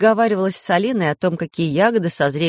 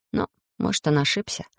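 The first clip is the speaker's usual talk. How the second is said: ordinary delivery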